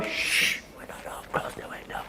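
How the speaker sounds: urgent hushed whisper. Retold or imitated. Parent hushing the family from a knocking visitor, whispered. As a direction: hiss it like hiding from the door.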